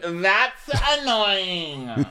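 put-on very drawn-out, high drunken whine; annoyed Terry. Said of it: "she's most annoyed about that".

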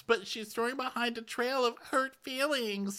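usual speaking voice

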